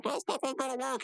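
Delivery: high squeaky cartoon voice; high character voice. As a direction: pitch way up for the character. Retelling cartoon, voicing character.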